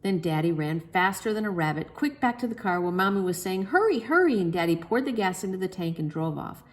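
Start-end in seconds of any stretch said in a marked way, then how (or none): 3.6-4.4 urgent hurry. A narrator's reading. Mommy urging Daddy.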